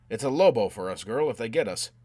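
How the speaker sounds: loud, pressing warning; urgent warning. A narrator's reading. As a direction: warn her forcefully over the din. Warning her during escape.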